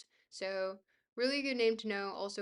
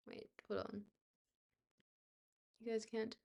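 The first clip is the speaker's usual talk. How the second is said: ordinary talk, nothing marked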